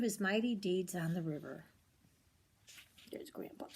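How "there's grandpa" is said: breathy whisper pointing something out; whispered aside. Real, not acted. Reader whispering to a child about the picture. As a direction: whisper it like a secret.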